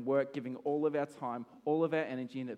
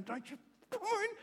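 high panicked don't you come in; a high frantic shriek keeping the attacker out; frantic high yell; 6 dB quieter than usual. Retold or imitated. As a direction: shriek to keep her out. Fending off attacker.

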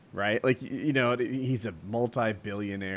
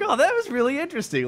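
much higher, louder mock-impressed; sarcastic high; 5 dB louder, faster than usual. Reacting to the interview, higher mock-impressed squeal. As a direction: gush it in a squeaky fake voice. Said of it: "I'm like".

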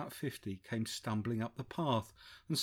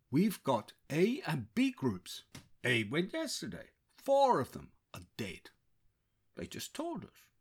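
put-on Indian American accent; 3 semitones higher than usual. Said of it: "a distinct American twang to his Indian accent".

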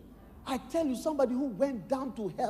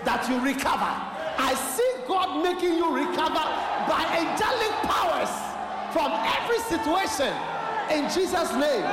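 high, loud preaching shout; angel's proclamation; 6 semitones higher, 6 dB louder than usual. Acted.

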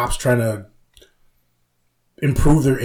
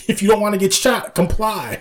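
lightly imitated cop, pitched up; mock cop warning; 9 semitones higher than usual. Retelling a sketch cop threatening kids, mock warning. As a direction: warn like a cop, half-joking.

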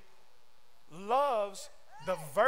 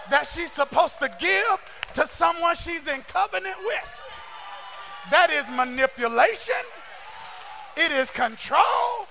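louder, shouted sermon cadence; preaching at full force; 3 semitones higher, 8 dB louder than usual. Acted. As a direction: drive the point home, preaching. Preacher builds again to shouting.

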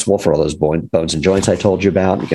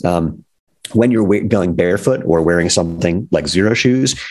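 ordinary talk, nothing marked

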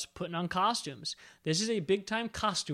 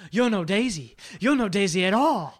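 louder drawling Doc Holliday imitation; Doc Holliday impression; 2 semitones higher, 7 dB louder than usual. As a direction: ham up Doc's southern gunslinger taunt. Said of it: "Doc Holliday goes".

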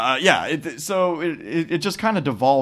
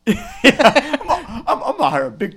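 much higher, laughing through the words; laughing retelling; 9 semitones higher, 3 dB louder than usual. Real, not acted. Cohost laughing while mocking Mark's plan. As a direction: giggle it out.

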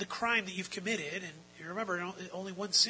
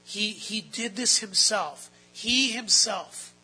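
louder, slow stress on "He himself"; "He himself" hammered out, higher and slow; emphatic; 4 semitones higher, 8 dB louder, much slower than usual. Shown as a demonstration. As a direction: lean hard and slowly on "He himself". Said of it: "it's emphatic".

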